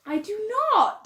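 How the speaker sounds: high, loud, drawn-out protest; offended squawk of denial; indignant. Real, not acted. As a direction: protest loudly in offended disbelief. Indignant denial of sounding posh.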